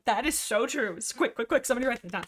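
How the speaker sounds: playfully urgent, as if it must be noted; mock urgency. Reacting to a chat comment, mock urgency.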